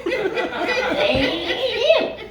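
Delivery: very high, slow mimicked catchphrase; quoted catchphrase. Comic repeats a catchphrase to laughs, high and drawn out. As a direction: drag the words out high in a put-on voice. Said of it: "If you understand what that is from".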